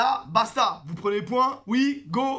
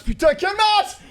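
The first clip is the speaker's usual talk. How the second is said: excited exclamation; 7 semitones higher than usual. Real, not acted. Exclaiming after the match.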